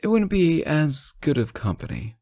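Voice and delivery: ordinary delivery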